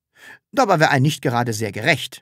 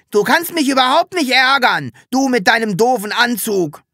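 much higher, louder raging yell; furious shout; 10 semitones higher, 5 dB louder than usual. A narrator's reading. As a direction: scream in helpless fury. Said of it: "schrie Hubert in höchster Wut".

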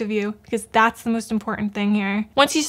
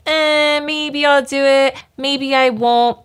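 higher, louder, slow and offhand; mock lazy shrug; 4 semitones higher, 3 dB louder, slower than usual. Mocking an undisciplined viewer, higher slow shrugging voice. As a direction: drawl out a careless maybe-I-will-maybe-I-won't. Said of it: "If you are just like".

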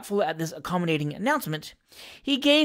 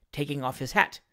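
ordinary delivery, nothing marked

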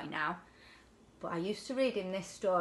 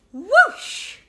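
high, loud whoosh; 14 semitones higher, 13 dB louder than usual. Acted. Tiddler shooting out of the fish, loud high whoosh. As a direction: fling the word out like he's shooting free.

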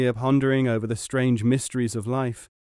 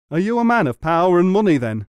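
high, loud, rushed astonishment; astonished gasp; 7 semitones higher, 8 dB louder, faster than usual. A narrator's reading. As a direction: blurt it out, aghast. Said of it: "gasped the man".

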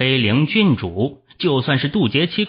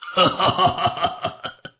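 high, crazed villain laugh; wild laughter; 7 semitones higher than usual. A narrator's reading. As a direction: laugh wildly as you strike. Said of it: "狂笑声响起的片刻".

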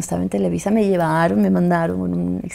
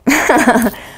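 laugh; 5 semitones higher, 4 dB louder than usual. Real, not acted. Laughs about free surgery.

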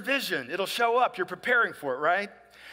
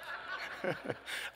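breathy little chuckle; quiet unvoiced laugh to himself; soft laugh; 13 dB quieter than usual. Real, not acted. Pastor chuckling at his own tissues joke, a soft laugh.

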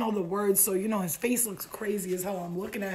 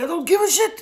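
very loud, high-pitched defiant shout; yelling; 10 semitones higher, 12 dB louder than usual. Retold or imitated. Suitor brushes off the other bar patrons, yelling. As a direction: imitate his outburst at full volume. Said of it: "He starts yelling".

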